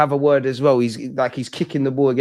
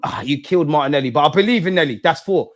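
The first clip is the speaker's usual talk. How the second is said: ordinary talk, nothing marked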